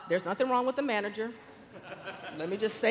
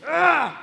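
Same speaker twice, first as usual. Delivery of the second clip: loud, raised "ugh" of nerves; loud gripped groan; 6 semitones higher, 9 dB louder than usual. Retold or imitated. Aunt describing her knotted stomach, loud nervous groan. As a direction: groan as if the gut clenches.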